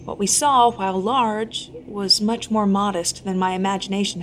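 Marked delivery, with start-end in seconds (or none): none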